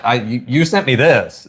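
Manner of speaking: usual speaking voice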